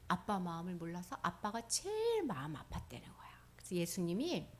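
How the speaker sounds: soft hushed telling; hushed storyteller voice; whispered narration. A narrator's reading. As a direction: whisper it to the kids.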